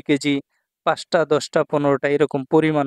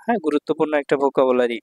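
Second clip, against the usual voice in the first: ordinary talk, nothing marked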